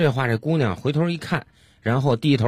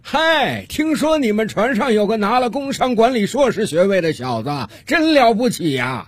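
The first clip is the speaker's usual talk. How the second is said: calling out; 10 semitones higher than usual. Acted. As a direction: shout it over to the next boat. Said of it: "朝他们这边喊道".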